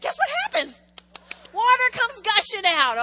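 high, loud and thrilled; excited reveal. Acted. Preacher thrilling the church with the water miracle, high and loud. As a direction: burst out with the good news.

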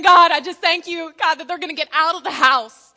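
loud hammed-up thanks to God; mock grateful prayer. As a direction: parents praying the kids move out. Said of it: "So you're just praying".

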